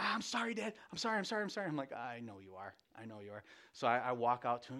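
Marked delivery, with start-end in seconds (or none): 0.0-1.7 put-on high, hurried little-kid pleading; high-pitched rush of apologies; apologetic child. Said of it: "he's like". 1.9-3.4 put-on low, soft reply to a child; understated, lowered fatherly acknowledgement; quiet firm father. Father answers pleading child.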